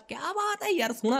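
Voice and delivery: high, delighted "wow"; delighted exclamation. Real, not acted. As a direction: gush over it in a high voice. Admiring the new setup.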